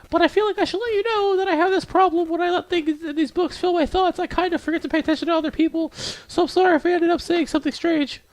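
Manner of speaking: very high falsetto confession; squeaky-high, sheepish bookish girl; apologetic shy girl. Acted. Voices Yuri apologizing.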